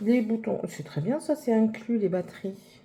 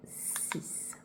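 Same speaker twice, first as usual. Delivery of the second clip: ordinary delivery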